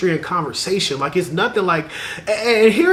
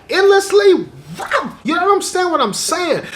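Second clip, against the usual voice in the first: excited gush; 13 semitones higher than usual. Real, not acted. Picturing endless talk about God and dreams, gushing. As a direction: get carried off by the idea.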